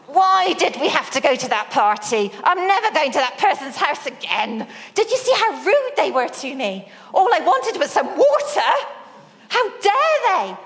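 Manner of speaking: mock furious tirade climbing high and fast at the end; ranting outrage. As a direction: replay the car tirade, climbing in pitch. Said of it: "I let Steve have it, all guns blazing".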